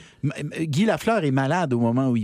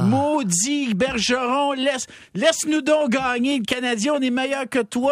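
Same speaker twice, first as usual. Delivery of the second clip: high, slow jeering yell at the coach; fan heckling; 11 semitones higher than usual. Retold or imitated. Fan in the stands jeering coach Bergeron, high mock yell. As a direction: taunt him from the stands, drawn out.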